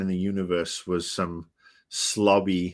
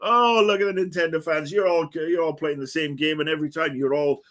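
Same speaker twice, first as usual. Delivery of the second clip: mocking; 7 semitones higher than usual. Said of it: "I was like".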